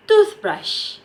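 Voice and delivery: TOOTH in "toothbrush" pitched very high; stress on TOOTH. Shown as a demonstration. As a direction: hit the first part of "toothbrush", let brush fall. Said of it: "the stress in the word tooth".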